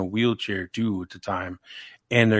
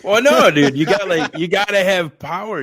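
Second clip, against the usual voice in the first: loud and high, worked up about it; bursting in to defend his battery packs; fired-up insistence; 9 semitones higher, 7 dB louder than usual. Real, not acted. Defending his battery packs to friends, loud excited insistence.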